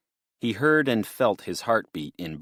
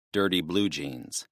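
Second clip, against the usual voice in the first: ordinary delivery, nothing marked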